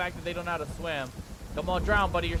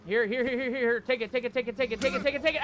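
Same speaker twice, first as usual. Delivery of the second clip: rapid-fire frantic repetition, pitched up; frantic urging; 4 semitones higher than usual. Real, not acted. Player urging Mutana to take the gun, frantic. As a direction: urge it on in a breathless rush of repeats. Urgently offers gun in game.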